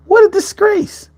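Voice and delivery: high squeaky what a disgrace; mock high outrage. Repeats the line mockingly high.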